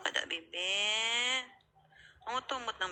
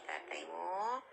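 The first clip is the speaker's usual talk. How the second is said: ordinary talk, nothing marked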